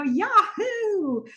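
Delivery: high, full whoop, held long; with some mustard. Retold or imitated. Donna showing Tripp how it's done, exuberant. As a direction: belt out the cheer with gusto. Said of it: "just put some mustard on it".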